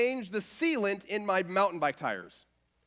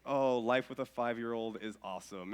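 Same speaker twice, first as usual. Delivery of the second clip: groggy irony; 10 semitones lower, 6 dB quieter than usual. Retold or imitated. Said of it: "It's like".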